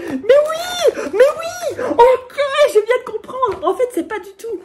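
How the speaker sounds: aigu et fort, comprend soudain; s'exclamer, tout excitée; cri de joie. Real, not acted. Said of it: "je suis complètement excitée". (cri très aigu d'enthousiasme)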